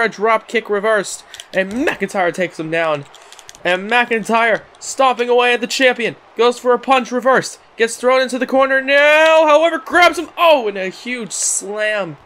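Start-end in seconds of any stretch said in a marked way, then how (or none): 3.6-12.2 raised voice building to the big hit; excited play-by-play. Acted. Commentator calling the challenger's attack, in excited play-by-play. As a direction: build the call up to the slam.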